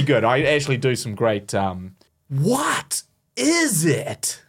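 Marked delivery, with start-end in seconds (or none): 2.3-4.4 slow, drawn out game voice line; Resident Evil game line. Shown as a demonstration. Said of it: "That's from Resident Evil the game".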